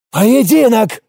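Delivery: hurt pride bursting out in one word; offended yell. Acted. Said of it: "завопил обиженный паренёк".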